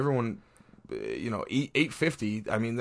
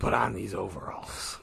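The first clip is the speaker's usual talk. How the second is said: unvoiced whisper, slower; mock whisper; 4 semitones lower, 3 dB quieter than usual. Whispering a joke order to a friend at the anthem, mock whisper. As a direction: whisper it like a stern usher.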